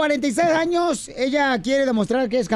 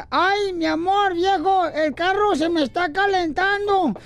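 higher, slow whiny wife; higher, slow wife whining to her husband about the car; pleading wife; 6 semitones higher than usual. Acted. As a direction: whine to the husband, drawn out and higher.

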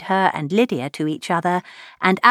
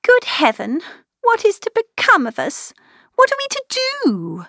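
high, woeful cry of despairing daughters; young girls lamenting, much higher voice; bitter lament. A narrator's reading. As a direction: wail it in high bitter woe. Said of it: "exclaim in the bitterness of woe".

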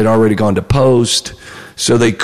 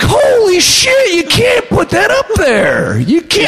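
very high, drawn-out mock panic; horrified protest; slower than usual. Retelling his shock at the screen credit, high hammed-up horror. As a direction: wail it out slowly, far above your usual pitch.

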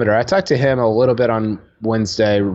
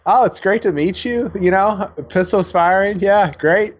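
cheery Hovland impression; 8 semitones higher than usual. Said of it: "that's just how he is".

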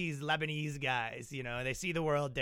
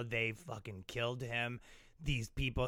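low bitter grumble; 4 semitones lower, 8 dB quieter than usual. Retold or imitated. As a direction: mutter it darkly. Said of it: "he's all like".

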